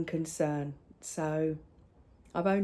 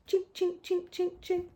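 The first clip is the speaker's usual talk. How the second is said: high clinking noise; 10 semitones higher than usual. Retold or imitated. Imitating jar clinking noise.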